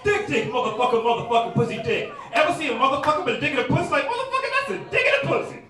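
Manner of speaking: loud, high-pitched mock stand-up patter; crude comics. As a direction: parody raunchy comics at full volume. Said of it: "every comic that went up before me was like".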